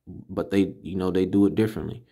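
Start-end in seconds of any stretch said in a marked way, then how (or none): none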